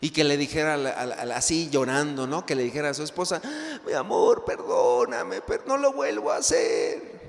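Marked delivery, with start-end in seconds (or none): 3.9-7.2 husband begging, voice rising to a high cry; hammed-up sobbing apology, climbing higher; husband pleading with his wife, mock crying; mock crying plea. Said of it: "Así llorando el hombre".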